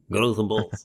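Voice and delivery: a badly faked Spanish accent on a retold taunt; mock Spanish accent, hammed up and clumsy; poor imitation of a Spanish rider's accent; terrible Spanish accent. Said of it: "That was a terrible accent".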